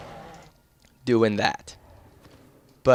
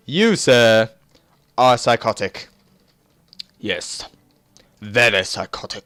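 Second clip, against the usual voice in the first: mock posh accusation; 2 semitones lower, 4 dB louder than usual.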